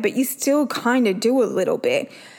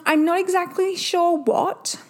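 normal talking voice

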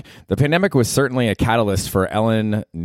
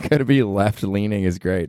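ordinary talk

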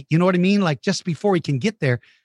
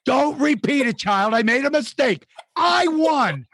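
mock frantic denial; 8 semitones higher, slower than usual.

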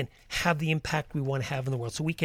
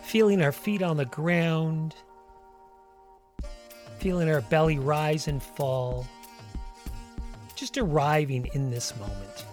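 guided meditation. Acted. Leads a walking meditation.

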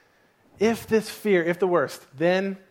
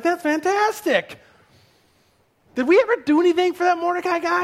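excited king; 11 semitones higher than usual. Acted. King hears of Mordecai, excitedly high. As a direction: pitch it up high with eager surprise. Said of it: "And he's like".

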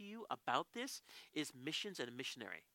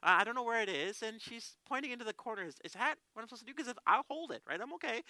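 high, worked-up explaining; flustered frustration; 6 semitones higher, 4 dB louder than usual. Retold or imitated. Visitor explains his washroom trouble to translator, frustrated. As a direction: plead your case to the translator, pitched up. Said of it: "I'm getting kind of frustrated".

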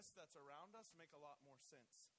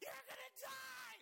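much higher, much louder, slow mock rage; furious little boy; 6 dB louder, much slower than usual. Little brother attacking his big brother, hammed-up high furious shout. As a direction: scream threats like a ticked-off five-year-old. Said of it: "And he's like".

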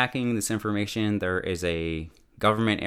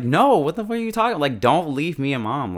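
high, loud, upset outburst; upset protest; 6 semitones higher, 4 dB louder than usual. Retold or imitated. Kate yelling at her dad not to leave. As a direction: beg him not to leave, angrily.

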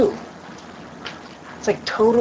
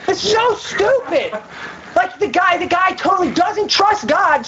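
high-pitched comic outrage; exasperated rant; 10 semitones higher, slower than usual. Real, not acted. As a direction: rant in disbelief at the silliness. Ranting about a saying.

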